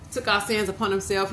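ordinary talk

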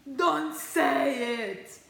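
drawn-out loud dramatic plea; mock protest. Begging her viewers not to mention her weight, loud and drawn out. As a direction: stretch it out like a panicked kid. Dreading the scale.

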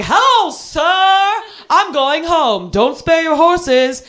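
put-on high, very slow, over-grand drunken greeting; drunk grandiosity. Drunk passenger greeting the taxi driver, grandly. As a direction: draw it out with hammed-up drunken pomp.

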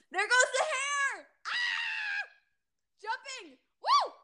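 high, loud whooping; excited shouting. Real, not acted. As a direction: whoop like a fan. Shouting along with the trailer.

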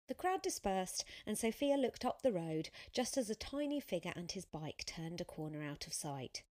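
ordinary delivery

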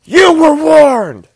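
very high, drawn-out bellow; James Earl Jones as Vader. Acted. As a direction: shout it slowly at full force as a villain. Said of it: "it's James Earl Jones going".

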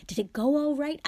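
high, loud, excited question; keen father itching to know; eager. A narrator's reading. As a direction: ask with undisguised enthusiasm. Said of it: "said Mister Weasley eagerly".

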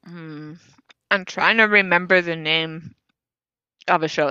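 talking with food in mouth; words around a bite; chewing while talking. Real, not acted. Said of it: "Sorry, I'm also eating".

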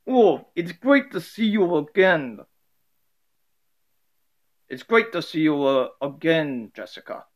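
amateur Russian character voice, lightly hammed; put-on Russian accent for an acted greeting. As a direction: play a Russian guy with a rough, homemade accent. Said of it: "the Russian guy being like".

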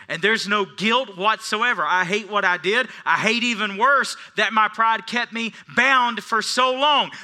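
loud, high declaration; triumphant preaching. Acted. Pastor proclaiming his freedom from guilt, loud and high. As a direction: proclaim it with rising fire.

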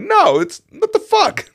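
very high, loud indignant squawk; angry. Retold or imitated. Customer retelling refusing to leave, high-pitched anger. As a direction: refuse in comic outrage, voice shooting up. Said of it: "I was fucking angry".

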